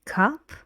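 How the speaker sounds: "Cup" said with a rising tune; teacher models an unfinished list item, rising. Shown as a demonstration. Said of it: "going up, your voice goes up".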